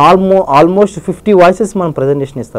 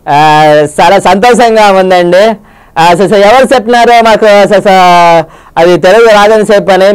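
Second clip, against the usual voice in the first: Rajasekhar impression. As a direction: drag it out with lots of "ఆ, so". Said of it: "Rajasekhar గారి యొక్క voice". (Rajasekhar గారి audio function మాట తీరులో)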